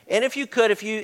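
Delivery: ordinary talk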